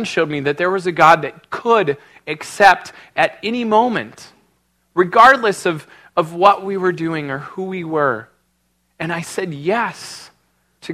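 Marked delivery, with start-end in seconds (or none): none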